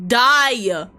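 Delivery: loud one-word outburst; sudden yell. Real, not acted. Player to game character who won't search pockets, sudden loud yell.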